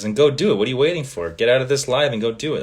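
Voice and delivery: ordinary talk, nothing marked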